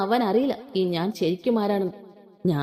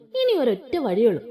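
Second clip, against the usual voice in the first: plain reading voice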